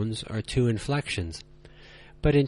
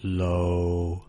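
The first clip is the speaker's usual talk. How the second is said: "low," held deep, lower and flat; low level tone; 6 semitones lower, 3 dB quieter than usual. Shown as a demonstration. Teacher showing learners the third tone, low and flat. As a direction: drop "low," to the bottom and hold it. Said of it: "Now you try it".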